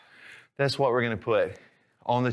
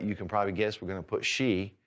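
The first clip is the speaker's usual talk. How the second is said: ordinary talk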